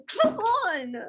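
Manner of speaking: high, drawn-out mock protest; foot-stomping mock tantrum. Playing along with a friend's tantrum bit, mock foot-stomping protest. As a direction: stomp your foot through the words. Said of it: "like, stopping your foot".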